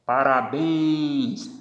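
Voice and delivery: parabéns drawn out, final béns stressed; slow stress demo. Shown as a demonstration. Teacher showing the class the stress in parabéns. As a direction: stretch parabéns and land on béns. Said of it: "olhem a tonicidade".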